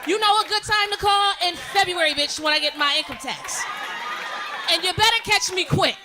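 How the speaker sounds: loud, indignant rant; cursing out bill collector. Acted. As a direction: tell the caller off at volume. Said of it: "I'm cursing you the fuck out".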